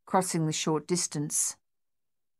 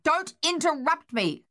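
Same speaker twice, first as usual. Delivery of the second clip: loud, higher furious shout; 10 semitones higher, 4 dB louder than usual. A narrator's reading. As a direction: cut her off with a shout. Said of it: "G W shouted".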